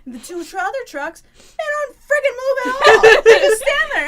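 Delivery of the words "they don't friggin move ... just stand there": high, loud indignant outburst; worked-up, high-pitched storytelling rant; loud exasperation. Real, not acted. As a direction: shout it in laughing disbelief. Indignant retelling of stalled traffic.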